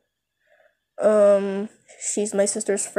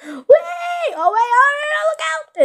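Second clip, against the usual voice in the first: high panicked shout of warning; gasping alarmed cry; 6 dB louder than usual. Acted. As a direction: yell a frantic warning, voice shooting up. Sudden crash alarm.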